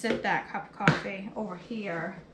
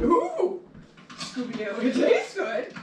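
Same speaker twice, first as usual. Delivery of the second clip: higher, louder goofy cartoon dog; Scooby-Doo voice; 3 semitones higher, 4 dB louder, slower than usual. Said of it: "I just sound like a cartoon character".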